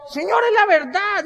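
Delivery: very high, loud, stretched-out plea; mock earnest insistence. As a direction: plead your case with exaggerated innocence. Se defiende ante la madre. (insistencia aguda y exagerada)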